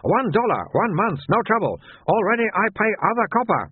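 high and insistent; high shopkeeper insisting he already pays; stubborn shopkeeper. Acted. Soon explaining protection.

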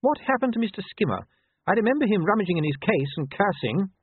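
lady's voice. Acted. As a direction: brave noblewoman, a touch rushed.

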